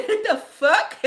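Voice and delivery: high, laughing mock disbelief; amused retold bewilderment; baffled, laughing. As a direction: blurt it out high while cracking up. Acting out her baffled reaction.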